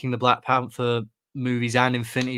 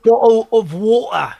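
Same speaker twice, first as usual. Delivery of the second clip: slow, higher, louder Cockney "Bottle of water!"; London accent; 9 semitones higher, 9 dB louder than usual. Retold or imitated. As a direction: echo it back, hamming up the Cockney. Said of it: "spoken with a London accent".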